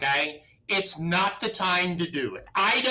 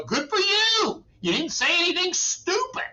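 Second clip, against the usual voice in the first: mock cheer; 12 semitones higher than usual. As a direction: congratulate them with a wry lift. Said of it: "And I go".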